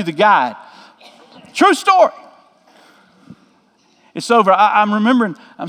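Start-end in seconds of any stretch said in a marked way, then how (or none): 1.5-2.1 higher, squeaky aside of mock insistence. Real, not acted. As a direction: vouch for it with a wink. Aside after a comic tale.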